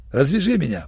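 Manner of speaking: ordinary delivery, nothing marked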